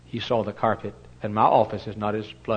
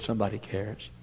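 tender reassurance; 2 semitones lower, 11 dB quieter than usual. Retold or imitated. Preacher comforting the girl, soft and tender. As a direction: gently tell her someone cares.

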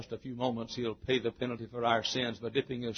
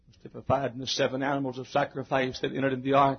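usual speaking voice

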